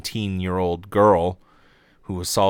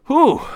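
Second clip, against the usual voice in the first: loud, high exhale to let the anger go; exasperated blowout; 10 dB louder than usual. Real, not acted. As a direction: blow out the frustration. After the angry rant.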